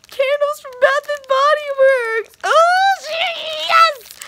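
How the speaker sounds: high, loud squealing delight; excited squeal. Real, not acted. Vlogger squeals about candles from Bath and Body Works, shrieking. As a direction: shriek with joy over the gift.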